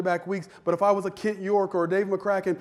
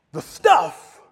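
savoring emphasis; 9 dB louder than usual. Acted. Preacher savoring the word.

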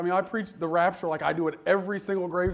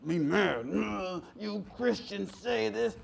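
slow, caricatured grumpy voice; mock angry atheist; 4 dB quieter, much slower than usual. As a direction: put on a dim, grumbling mad atheist. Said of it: "So why are you so mad?".

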